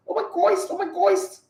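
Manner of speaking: mocking fake Nigerian girls. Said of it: "fake Nigerian girls".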